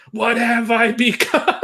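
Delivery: playful melodramatic lament; teasing dramatic. Pretend. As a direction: ham up the despair to tease a friend. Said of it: "Just to tease him".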